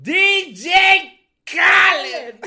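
hype-man shout. Pretend. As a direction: bellow it like a hype man on the track. Anuncia al DJ a gritos. (grito de presentador de hip hop)

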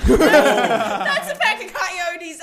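high, loud, drawn-out mock panic; retold loud shock. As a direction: belt out the stunned discovery, comically stretched. Repeats coyote shock, louder.

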